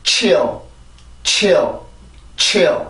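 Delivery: chill with an airy CH gliding into dark L; pronunciation demo. Shown as a demonstration. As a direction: model chill slowly for learners, one at a time. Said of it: "자, 해봅니다". (chill을 '치얼'처럼 이어서 또박또박 발음)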